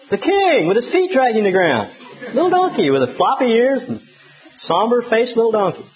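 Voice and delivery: laughing at the picture as he paints it; amused laughter. Real, not acted. Preacher to congregation, amused and chuckling.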